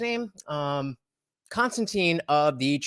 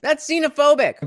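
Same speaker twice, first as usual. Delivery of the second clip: mock indignant retort; 9 semitones higher, 6 dB louder than usual.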